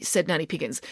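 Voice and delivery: ordinary delivery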